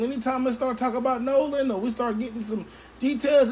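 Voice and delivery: normal talking voice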